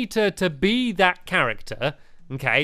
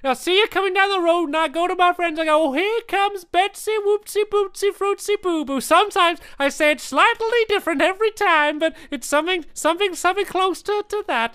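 raised-pitch playful lizard voice; gecko character voice; 13 semitones higher, 4 dB louder than usual. Acted. Gecko greeting his old friend Betsy, raised playful character voice. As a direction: play the fussy old gecko catching up.

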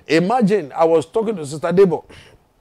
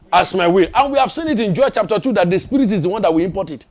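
ordinary talk, nothing marked

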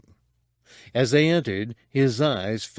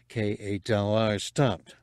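usual speaking voice

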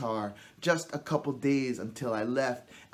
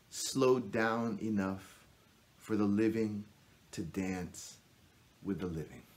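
hushed poetry recital; 5 semitones lower, 4 dB quieter than usual. Acted. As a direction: let each phrase drop lower and softer, with pauses. Recites a poem, stanza closing.

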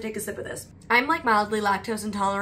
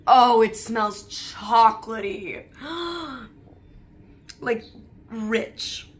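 delighted; 4 dB louder, much slower than usual. Real, not acted. Delighted at chocolate milk smell.

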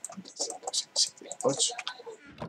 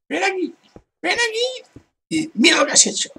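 much higher mocking voice, fast at the end; high mocking imitation; mock call. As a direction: ham up the player calling you over.

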